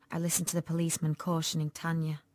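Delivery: ordinary delivery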